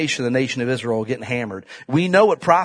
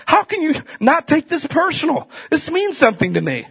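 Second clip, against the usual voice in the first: higher pitched pleading; retold plea; 11 semitones higher than usual. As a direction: plead with the man. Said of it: "I'm like".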